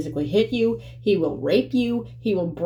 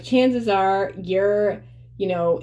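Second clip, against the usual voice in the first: usual speaking voice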